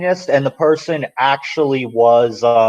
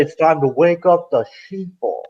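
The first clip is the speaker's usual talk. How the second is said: higher-pitched, half-hearted mimicry of another man; poorly imitated voice; 4 semitones higher than usual. Mocking a video maker to friends, a badly imitated voice. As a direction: attempt his voice, not very well.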